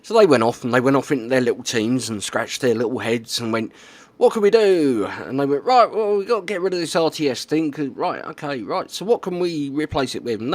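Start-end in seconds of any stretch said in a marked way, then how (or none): 4.2-5.1 high, louder puzzled voice; puzzled team voice. Retold or imitated. 5.6-10.4 high, quick team chatter; brainstorming team voice. Retold or imitated.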